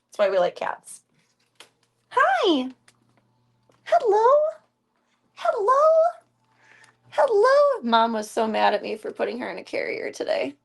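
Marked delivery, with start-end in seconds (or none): 2.1-7.8 very high sing-song hellos, louder. Real, not acted. Greeting her cat in high pet-talk voice.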